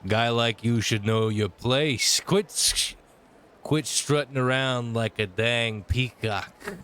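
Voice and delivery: put-on lightly held thug accent, slow and taunting; slow mock-threatening thug drawl; tough-guy thug accent. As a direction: sneer at him like a street thug. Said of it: "I don't know why I have this accent".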